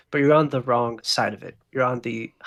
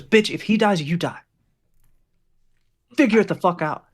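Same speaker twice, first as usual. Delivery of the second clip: louder, raised gunman voice; angry threat; 6 semitones higher, 6 dB louder than usual. Acted. Gunman warning nurse the patient must live, angry threat. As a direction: deliver a forceful ultimatum.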